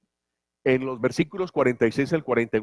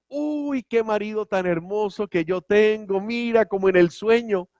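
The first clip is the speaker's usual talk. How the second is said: high, gushing delight over the gift; happy wife; 7 semitones higher than usual. Acted. Preacher playing the wife overjoyed at her husband's gift, high and gushing. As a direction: coo like a thrilled wife opening a present. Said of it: "Y ella feliz".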